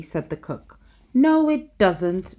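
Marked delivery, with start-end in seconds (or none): 1.1-2.3 put-on slow, high, loud stubborn child; bossy little princess. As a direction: drag out a petulant no. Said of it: "said the little princess".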